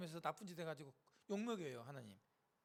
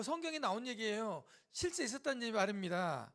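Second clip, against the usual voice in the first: normal talking voice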